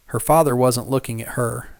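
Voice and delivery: plain reading voice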